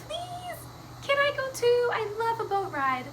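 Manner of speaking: high-pitched little kitty voice; pleading cat. Acted. Cat asks Mister Gumpy for a ride, high pleading. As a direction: beg in a tiny high cat voice. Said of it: "Cat said".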